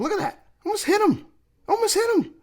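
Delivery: very high-pitched squeal of disbelief; incredulous outburst. Real, not acted. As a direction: exclaim it sky-high, astonished at the call. Outraged at replay of bad call.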